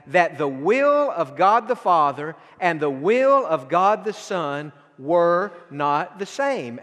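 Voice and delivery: ordinary delivery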